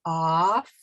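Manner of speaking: Off said clearly with an open ah; model word. Shown as a demonstration. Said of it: "Say it again by yourself".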